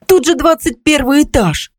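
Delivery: high-pitched, alarmed exclamation; frightened cry. A narrator's reading. Neighbour warns of the twenty-first floor, frightened cry. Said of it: "испуганный вскрик Светланы".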